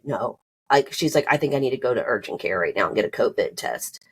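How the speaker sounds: subdued, under-the-weather retelling; feeling unwell. Retold or imitated. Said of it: "I could tell she did not feel good".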